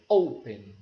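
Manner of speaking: "Open" with a strong O, falling away after; "Open" stressed at the front, low falling end; stress on first syllable. Shown as a demonstration. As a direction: punch the O of "Open", let the ending drop. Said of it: "a sílaba mais forte, a tônica, é a primeira".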